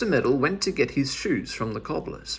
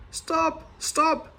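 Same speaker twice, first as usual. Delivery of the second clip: yelling; 14 semitones higher than usual. A narrator's reading. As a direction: call after him sharply. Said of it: "yelled the big man".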